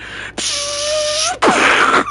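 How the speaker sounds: long high hiss bursting into a blast; mouth-made thermite grenade noise; imitated grenade explosion. Storyteller mimicking his phone blowing up, imitated explosion. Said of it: "Like a fucking thermite grenade".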